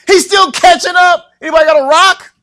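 high, loud panicked shout; crying out in fear. Acted. Disciple watching the ghost catch up, frantic yelling. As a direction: yell in comic terror. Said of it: "they cried out for fear".

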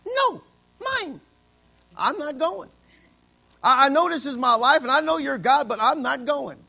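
put-on Jonah as a whiny child, high then easing down; childish Jonah. Preacher voicing Jonah refusing God like a child, whiny and high. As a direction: pout like a stubborn kid refusing God. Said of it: "he says".

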